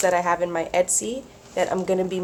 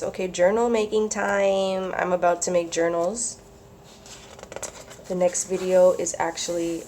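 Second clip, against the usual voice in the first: ordinary talk, nothing marked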